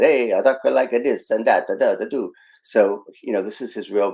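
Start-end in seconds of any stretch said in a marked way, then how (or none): 0.0-2.3 mocking a cliché movie Italian accent, higher and louder. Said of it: "where all the Italian accents said".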